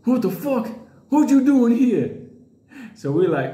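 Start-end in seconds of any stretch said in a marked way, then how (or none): 0.0-2.3 Earl's mock yell at the intruder. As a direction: call out the bear like Earl. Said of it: "Earl go".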